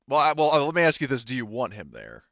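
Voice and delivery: normal talking voice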